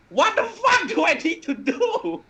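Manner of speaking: very high, drawn-out scream; exasperated scream. Acted. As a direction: scream it in the opponent's face, hammed-up. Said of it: "I just scream at her face".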